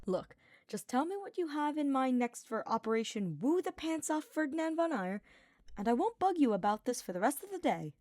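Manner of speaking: cocky teasing Sylvain. Acted. Sylvain pestering Hubert about Ferdinand, teasing. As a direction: needle him with a grin in your voice.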